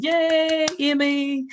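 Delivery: high, drawn-out happy squeal; excited cheer. Real, not acted. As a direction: greet them in a high sing-out cheer. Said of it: "I'm so excited".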